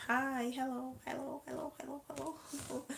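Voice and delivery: high-pitched greeting. Real, not acted. Host greeting viewers joining the live stream, high-pitched and playful.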